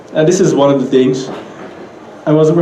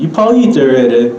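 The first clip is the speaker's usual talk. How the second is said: quicker, with "already" dropping at the end; Pidgin question intonation; faster than usual. Shown as a demonstration. Teacher repeating the Pidgin example, falling on "already". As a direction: peak on "eat", then fall away on "already". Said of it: "it rises and then drops at the end".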